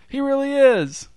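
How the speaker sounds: very high excited exclaim; thrilled cry. A narrator's reading. Spectator marveling at the gorilla on the bike, thrilled cry. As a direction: squeal in delight. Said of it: "shouted another".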